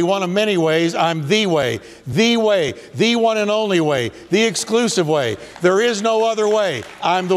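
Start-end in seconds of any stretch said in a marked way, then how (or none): 0.9-5.4 each "the" punched, mostly as "thee"; emphatic THE. Acted. Preacher insists Jesus is no mere option, stressing "the" as "thee". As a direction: insist on "the" in every phrase. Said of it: "he uses the emphatic".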